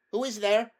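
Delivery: high, loud challenge; startled call. A narrator's reading. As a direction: call out to whoever is outside the gate. Startled Aravis calls through gate.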